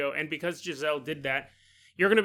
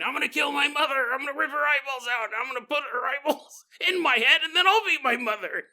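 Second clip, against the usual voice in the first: high, fast rant of a raving patient; mock crazed screaming; 9 semitones higher, 3 dB louder than usual. Imitating psych patient heard across the room, mock crazed screaming. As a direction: rave hysterically, without full volume. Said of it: "you hear a guy screaming".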